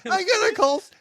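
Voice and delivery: very high, loud put-on voice; silly call-out voice. Co-host teasing about the phone call, high silly voice. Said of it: "use that voice too".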